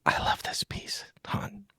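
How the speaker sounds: soft whispered aside; hushed, intimate whisper to a friend. Real, not acted. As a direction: breathe it, barely voiced. Whispered aside after the poem.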